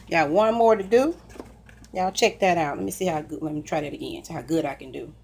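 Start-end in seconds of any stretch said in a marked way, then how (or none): none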